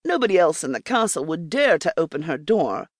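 ordinary delivery, nothing marked

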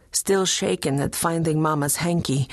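ordinary delivery

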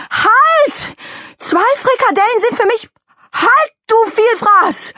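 very high, full-volume yelling. Acted. Mickey Kuchen demanding two meatballs from the glutton, yelling. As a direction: yell to stop a greedy eater. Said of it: "Da rief Mickey Kuchen noch lauter".

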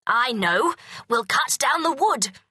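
a burst of kingly inspiration; excited king. A narrator's reading. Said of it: "he cried".

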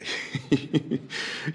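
hushed, breathy snickering; a quiet, stifled madman's laugh; mad snicker. Acted. Madman relishing his stealthy opening of the lantern, snickering. Mad narrator's gleeful interruption.

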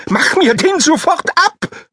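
very high, slow wailing plea; howling whine. A narrator's reading. Schornstein whining at the teacher to remove the dog, high slow howl. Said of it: "jaulte der Schornstein".

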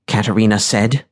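usual speaking voice

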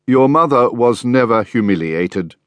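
usual speaking voice

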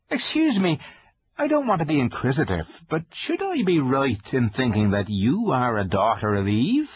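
high, timid fawn voice; nervous polite faun; shy fawn voice. Acted. Fawn character voice.

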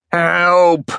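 stabbed soldier calls for help, ringing out; strong cry for help. Acted. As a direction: call out for help with surprising strength. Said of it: "The soldier's voice rang out, surprisingly strong".

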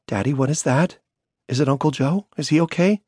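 a scared daughter pleading for answers; frightened little girl. A narrator's reading. Daughter voiced by narrator.

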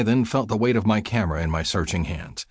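ordinary delivery

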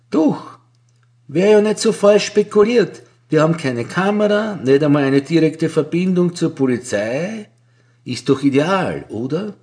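lightly voiced Frau Vajvoda. A narrator's reading. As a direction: lightly play the bored older clerk.

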